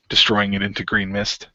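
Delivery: ordinary talk, nothing marked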